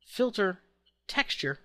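normal talking voice

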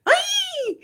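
a very high, shouted squeaky "ay"; silly high squeal. Retold or imitated. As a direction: squeal it out like a fool to call him. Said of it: "al hacer". (chillido muy agudo para atraer al cachorro)

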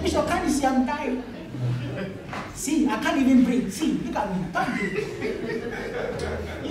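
high, laughing mock dying man; laughing while imitating. Pastor mimics dying brother, half laughing. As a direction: acts out a gasping sick brother, laughing. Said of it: "You are laughing".

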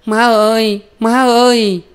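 put-on very high, held child's cry; calling out loudly. A narrator's reading. Eldest girl calling after her mother, high and loud. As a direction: call far after mother, stretching it. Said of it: "Con chị lớn gọi to".

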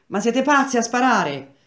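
urlo acuto e forte; grido. A narrator's reading. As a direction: grida incredulo, a voce alta e acuta. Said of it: "aveva gridato il tedesco". (gridato con tono alto)

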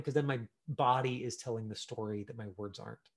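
usual speaking voice

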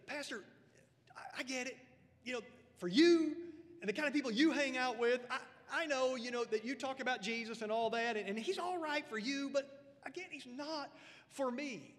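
imitating a dismissive churchgoer; skeptic's voice. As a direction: play the doubter brushing Jesus aside. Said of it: "Sometimes people tell me".

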